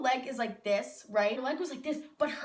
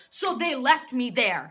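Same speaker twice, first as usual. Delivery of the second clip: louder, aggrieved emphasis; indignant; 2 semitones higher, 7 dB louder than usual. Real, not acted. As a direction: state the grievance loudly. Indignant retelling of being abandoned.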